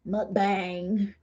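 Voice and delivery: slow, drawn-out American accent; broad American accent, tipping into laughter. Shown as a demonstration. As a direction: say Mukbang in an exaggerated American accent. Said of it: "in the American accent".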